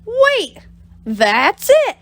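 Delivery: high excited "Wait"; light bulb moment; sudden realisation. Acted. Bob has a sudden idea.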